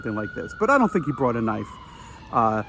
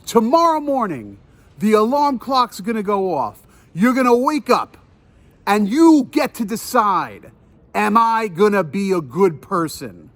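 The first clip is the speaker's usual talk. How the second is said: slow, raised-pitch mock moral lecture; preachy scolding; 4 semitones higher than usual. Man points at the couple and lectures on choices, re-enacted sermonizing. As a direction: lecture them with deliberate, righteous insistence. Retold lecture continues after aside.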